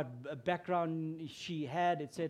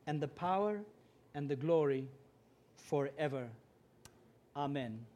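solemn recitation. Acted. Pastor reads Matthew six to the church, solemn recitation. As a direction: proclaim each line like scripture. Recites the Lord's Prayer aloud.

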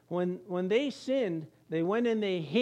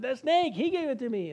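whiny excuse; 5 semitones higher than usual. Acted. Eve blaming the snake to God, whiny. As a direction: blame the snake like a caught child. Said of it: "And then the woman said".

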